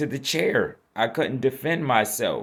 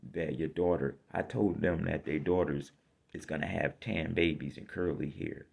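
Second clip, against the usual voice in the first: normal talking voice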